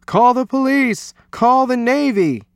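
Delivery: high, loud cries for help; panicked shriek. A narrator's reading. Coach panicking over the creature, loud high shriek. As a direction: shout each order up high in alarm. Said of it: "shrieked the coach".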